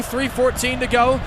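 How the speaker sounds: normal talking voice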